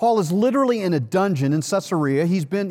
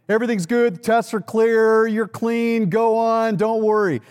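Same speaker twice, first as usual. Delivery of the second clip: higher, light and cheerful all-clear; imitating a breezy doctor with the all-clear, higher; breezy good-news doctor; 6 semitones higher, 3 dB louder than usual. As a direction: toss off the good news like it's nothing. Imagined doctor giving good news.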